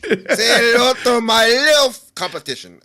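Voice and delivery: loud, high gangster send-up breaking into laughter; Scarface impression. Friend mocking the host's attempt, laughing Scarface impression. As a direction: belt out the gangster line, laughing. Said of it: "Was that your best Scarface impression".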